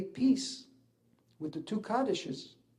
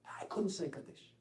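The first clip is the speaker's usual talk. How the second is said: hushed whisper; 9 dB quieter than usual. Real, not acted. Retelling choking up at memorial.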